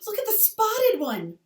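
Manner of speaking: high, loud and slow, pointing eagerly; excited child. Acted. Little Laura points out a spotted pony to Pa, thrilled. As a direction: drag it out, delighted by the pony.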